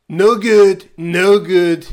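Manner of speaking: loud, blustery Trump voice; Donald Trump impression. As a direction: bark it like Trump. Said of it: "Eu só sei fazer do Donald Trump".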